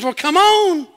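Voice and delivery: high, loud beckoning call; Jesus calling out. Retold or imitated. Jesus answers Peter in the boat, calling out. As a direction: call him over from far across the water.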